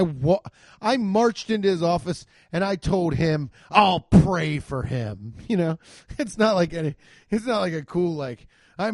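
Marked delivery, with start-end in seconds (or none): 3.7-5.3 slow, loud, self-righteous put-on voice; pious indignation. Parodies pious quitting employee.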